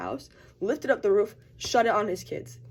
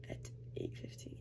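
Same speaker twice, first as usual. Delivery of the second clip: whispered aside; 15 dB quieter than usual. Real, not acted. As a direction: drop to a whisper for the time. Getting ready early, muttered time.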